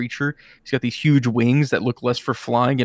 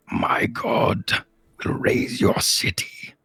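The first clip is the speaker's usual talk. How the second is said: unvoiced, slow rasping threat; demon whisper; 3 dB quieter, much slower than usual. Greater demon threatening the wizard's city, whispered.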